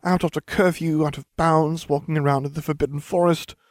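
high, worried listing; fretting. A narrator's reading. Hermione lists their offences in the forest, fretting. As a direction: fret through each broken rule. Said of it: "Hermione fretted".

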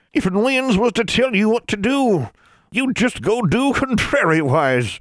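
high, very slow crone; ancient crone voice. A narrator's reading. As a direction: creak it out like an ancient witch. Crone speaks.

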